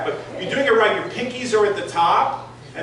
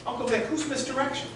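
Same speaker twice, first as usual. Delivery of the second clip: put-on higher, quick, softer child's question; a kid mishearing a big word; puzzled little boy; 6 semitones higher, 8 dB quieter, faster than usual. Boy asks his uncle who misdirection is, higher puzzled child voice.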